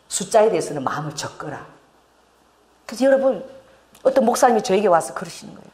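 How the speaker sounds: usual speaking voice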